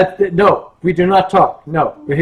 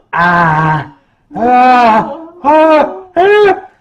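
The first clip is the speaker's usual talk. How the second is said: mock cries climbing higher and louder; Chester's startled cries; 5 semitones higher, 7 dB louder, much slower than usual. Mascot runs into performer's mother, rising mock yelps. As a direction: yelp in mock alarm, each one higher. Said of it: "And Chester said".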